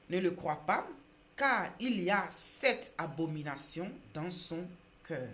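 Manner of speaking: loud, slow, solemn proclamation; Bible reading. Acted. Preacher reading a proverb to viewers, loud solemn reading. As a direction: read scripture out with weight, pausing between phrases. Reads Bible verse aloud.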